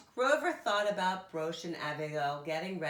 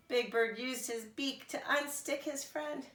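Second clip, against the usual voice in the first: ordinary delivery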